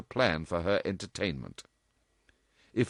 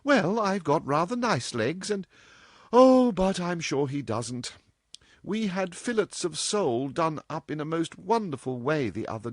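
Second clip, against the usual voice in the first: put-on a higher, playful young woman; lightly voiced cheeky girl; 8 semitones higher than usual. A narrator's reading. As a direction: tease her mother lightly. Dorothy voiced in story.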